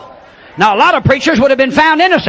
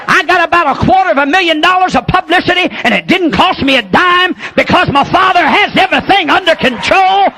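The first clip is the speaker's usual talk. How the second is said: preaching at full force; 7 semitones higher than usual. Acted. Preacher shouting to the congregation about revival. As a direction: lift the crowd with fiery preaching.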